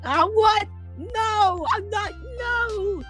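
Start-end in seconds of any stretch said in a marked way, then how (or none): none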